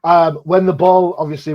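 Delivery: ordinary talk, nothing marked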